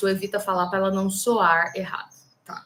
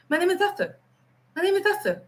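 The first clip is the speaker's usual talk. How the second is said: higher-pitched British mumble, R dropped; British accent; 9 semitones higher than usual. Retold or imitated. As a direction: imitate an unintelligible Brit swallowing the R. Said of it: "Só que ele falou Arthur".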